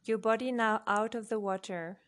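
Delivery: ordinary delivery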